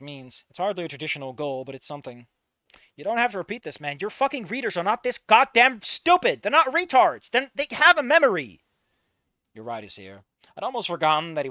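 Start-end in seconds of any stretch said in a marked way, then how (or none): 3.0-8.6 loud, irritated rant at the narrator; angry. Real, not acted. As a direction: snap at him, fed up and loud. Chat interrupts reader angrily.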